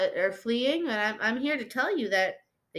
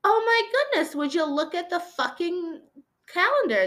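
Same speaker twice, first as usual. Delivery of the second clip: much higher, gasping surprise; stunned discovery; 9 semitones higher, 3 dB louder than usual. Real, not acted. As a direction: realise it all at once, high and loud. Sudden realisation of the date.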